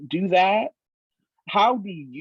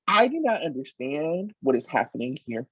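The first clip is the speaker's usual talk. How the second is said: normal talking voice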